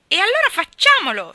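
put-on very high, loud child's shriek. A narrator's reading. Geraldine urges Gerald to attack Ivy, shrieking. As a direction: squeal it out as a battle cry. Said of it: "strillò Geraldine".

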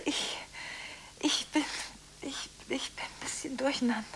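soft, shaken whisper; confused whisper. Acted. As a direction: admit it in a whisper. Confessing confusion.

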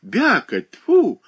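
put-on high and loud little girl; a toddler scolding her mother; spitting child. A narrator's reading. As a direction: spit the words like an angry toddler. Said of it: "плевалась девочка".

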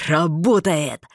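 mild triumphant shout; restrained exultant yell; joyful yell. A narrator's reading. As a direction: call it out gladly in a man's voice. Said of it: "заорал радостный Марстон".

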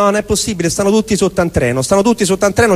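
usual speaking voice

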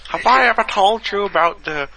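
high, loud drawling Texas grandpa voice; old Texas accent. Pretend. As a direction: tell it like an old Texan grandpa. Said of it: "I don't know where that accent came from".

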